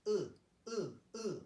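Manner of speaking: "uh" as the vowel unfamiliar in English; Korean EU sound. Shown as a demonstration. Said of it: "This is a unique sound".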